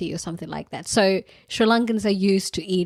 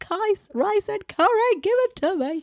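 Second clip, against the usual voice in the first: mock excited; 13 semitones higher than usual. Quoting her craving self.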